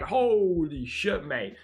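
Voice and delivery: drawn-out slow, high awed holy shit; amazed gasp. Retold or imitated. Amazed at dream gift.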